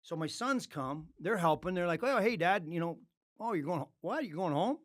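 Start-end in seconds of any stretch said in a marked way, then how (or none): 2.0-4.8 higher, louder surprised exclamation; a son caught off guard. Retold or imitated. Sons helping unpack, startled their dad is going home, a louder, higher surprised voice.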